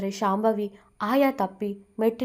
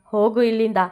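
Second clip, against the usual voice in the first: loud, harsh order to get out; angry order; 4 dB louder than usual. Acted. Shambhavi ordering Sudha out, angry. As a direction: throw her out of the room with fury.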